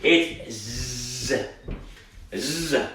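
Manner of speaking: ordinary talk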